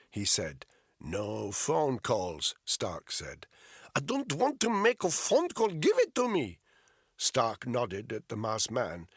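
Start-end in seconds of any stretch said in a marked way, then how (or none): 1.0-2.5 slow firm refusal. Acted. Interrogator refusing curtly. 3.9-6.6 put-on high, insistent Russian-accented English, rising louder; thick Russian accent. Polyakov voiced throughout.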